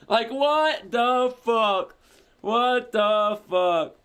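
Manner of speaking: loud, high, drawn-out disbelief; incredulous outburst. Real, not acted. Streamer stunned by the entire scene, loud incredulous exclamation. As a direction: stretch it out loudly in disbelief.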